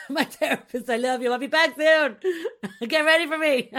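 laughing through a loud, fond goodbye; laughing fondly. Real, not acted. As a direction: call out to her therapist, giggling. Laughing message to her therapist.

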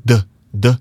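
short hard d, d; d sound demo. Shown as a demonstration. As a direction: tap out the d sound twice. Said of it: "take away the D sound".

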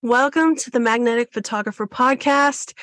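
ordinary talk

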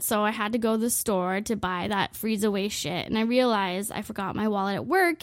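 usual speaking voice

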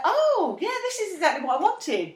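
high, bright sudden realisation; surprised delight. Retold or imitated. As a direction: gasp it out, pleased and high. Recalled realisation.

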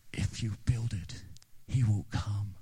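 eerie whispered voice from nowhere; hushed creepy whisper. Retold or imitated. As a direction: breathe it like a ghostly voice in the corn. Movie voice from cornfield.

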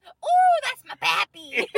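high, slow cartoon grandpa coo; high, slow doting old man voice. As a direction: croon it like a goofy old man. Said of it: "And then he goes".

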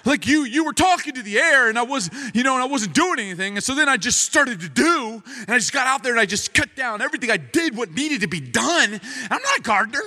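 high-pitched, indignant mock rant; heated self-defense. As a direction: act out a husband arguing back. Retold argument, husband defends himself.

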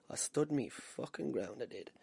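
slow, quiet, dropping away at the end; emphatic repeat. Real, not acted. Amateur boxer insisting on his courage, slow and quiet. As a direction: repeat it softly and deliberately.

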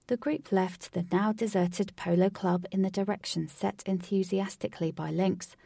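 ordinary delivery, nothing marked

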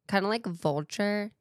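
ordinary delivery

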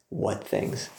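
hushed playful whisper; whispering the coy reply. Retold or imitated. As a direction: breathe it like a secret. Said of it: "repeating Jesus' line".

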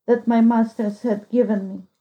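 ordinary delivery, nothing marked

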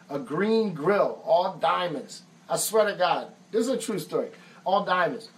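ordinary talk, nothing marked

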